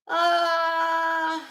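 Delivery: loud, high yelp of alarm; a startled shout; sudden cry. Real, not acted.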